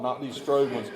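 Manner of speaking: ordinary talk, nothing marked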